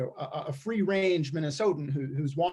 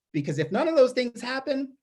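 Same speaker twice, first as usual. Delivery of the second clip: normal talking voice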